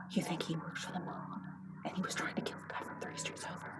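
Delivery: very quiet, breath-only gossip; hushed, scandalized speculation; conspiratorial whisper. Acted. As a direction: whisper your wild theory. Wife hatches mob theory.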